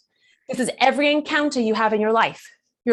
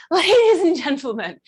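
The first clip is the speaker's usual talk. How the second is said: raised, ringing show-opening call; stage announcer; 5 semitones higher, 4 dB louder than usual. Retold or imitated. Performer psyching herself up at a networking event, grand announcer. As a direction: announce yourself like a cabaret host. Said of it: "So I just stood there and went".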